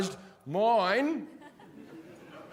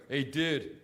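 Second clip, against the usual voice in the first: lower, softer dude-bro voice; lazy frat guy pitching a game; lightly voiced college bro at a bar; college boy; 4 semitones lower, 5 dB quieter than usual. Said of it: "college boy who'll come to me and go".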